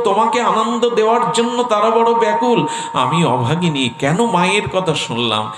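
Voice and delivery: high-pitched devotional lamenting, acted out; impassioned lament. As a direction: cry out the regret in a sustained high pitch. Madhavi laments obeying her mother. (চড়া গলায় আকুল আক্ষেপ)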